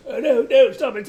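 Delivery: a terrified Doctor pleading; high-pitched mock panic; panicked plea. Acting Patrick's frightened Doctor face.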